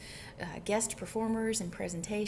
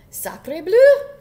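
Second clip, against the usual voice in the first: very loud, high French exclamation; indignant French duck outburst; French duck's cry; 8 semitones higher, 19 dB louder than usual. A narrator's reading. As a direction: shriek it with a hammy French accent. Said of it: "cried the duck".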